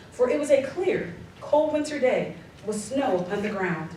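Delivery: ordinary talk, nothing marked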